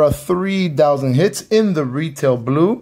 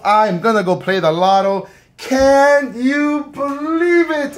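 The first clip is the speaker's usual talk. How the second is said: overjoyed; 8 semitones higher than usual. Real, not acted. Collector tells viewers he finally pulled it, high and overjoyed. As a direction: gush with real joy. Still celebrating the pull.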